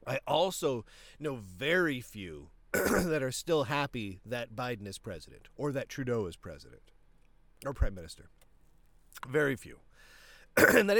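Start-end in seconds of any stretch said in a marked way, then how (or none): none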